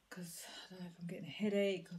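tired, unvoiced whisper. Real, not acted. Vlogger whispers to viewers about a coming headache, whispering. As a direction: let it drop into a weary whisper.

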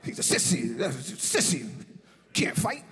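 low, dismissive sneer. Retold or imitated. John Witherspoon dismissing Paul Mooney, low sneering scorn. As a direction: spit out sissy like a joke.

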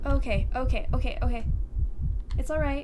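loud, repeated self-steadying; rattled. Real, not acted. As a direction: repeat it loudly to steady your nerves. Steadying self after scare.

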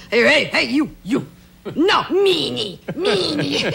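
lightly voiced guard, slow on meanie; mock the Vatican guard scolding; guard calling out. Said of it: "And then".

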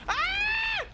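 sudden high shriek of panic; panicked scream. Real, not acted. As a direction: shriek as enemies swarm in. Panicked screaming at swarm of enemies.

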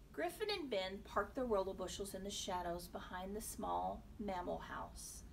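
plain reading voice

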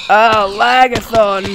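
loud, slow drawn-out cry; loud dismay. Real, not acted. Gamer watching the game lag, loud groan. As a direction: wail at the lag.

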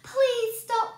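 high, loud pleading; begging. A narrator's reading. Boy begging the pot to stop, high and loud. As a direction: plead desperately. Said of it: "begged the boy".

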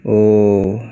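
usual speaking voice

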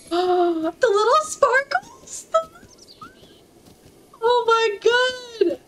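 breathless delighted awe; awed squeal. Real, not acted. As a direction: melt over the sparkles. Gasps at horse's sparkles.

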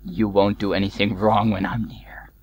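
hushed, menacing whisper; creepy whisper. Acted. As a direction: whisper it like a stalker. Stalking another player.

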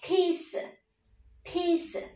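peace pitched high, dropping to a light s; high stressed syllable. Shown as a demonstration. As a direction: lift the vowel of peace, let s fall away. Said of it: "các con sẽ đọc cao giọng nhá". (peace cao giọng, hạ ở âm s)

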